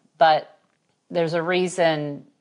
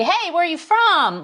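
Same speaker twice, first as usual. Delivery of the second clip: much higher, bright chirpy welcome; overeager greeter; 13 semitones higher, 5 dB louder than usual. Retold or imitated. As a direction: play the too-friendly church greeter. Imitates an overeager church greeter.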